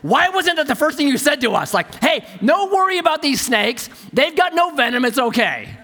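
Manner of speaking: mock outrage at the guide; incredulous scolding; exasperated complaint. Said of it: "I went".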